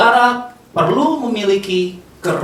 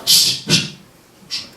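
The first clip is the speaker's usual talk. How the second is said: shushing; slower than usual. Acted. Imitating people shushing.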